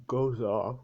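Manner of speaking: talking through a real yawn. Real, not acted. Storyteller yawning while narrating the buzzer, yawny. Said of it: "Need to yawn again".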